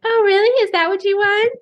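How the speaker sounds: high mocking girly voice; squeaky teasing girl impression. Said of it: "that mocking girl voice that you do".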